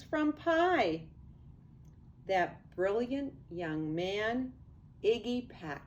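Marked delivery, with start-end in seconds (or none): none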